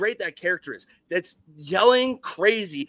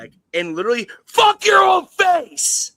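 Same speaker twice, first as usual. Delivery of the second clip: loud, raised profane movie-boss shout; Les Grossman impression; 5 semitones higher, 5 dB louder than usual. Impersonating Les Grossman, shouting down the phone. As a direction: bark it like a furious studio boss. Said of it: "my best Les Grossman impersonation".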